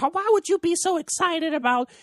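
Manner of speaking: high mock-scolding girlfriend. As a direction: mimic her disapproving tone in a high voice. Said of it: "She's like".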